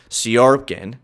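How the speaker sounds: normal talking voice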